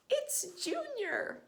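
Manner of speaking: father bird proudly announcing his new chick; excited shout. Acted. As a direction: call out in delight. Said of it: "he shouted".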